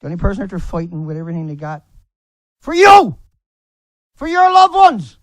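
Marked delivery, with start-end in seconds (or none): 2.6-5.2 much higher, louder crying out; desperate screamed plea; anguished yell. Real, not acted. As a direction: shout the sacrifice at them. Anguished rant at critics.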